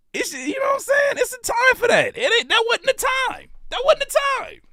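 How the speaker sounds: mock-high outraged insistence; high-pitched rant. Real, not acted. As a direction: squeak it out high in protest. Ranting serious time.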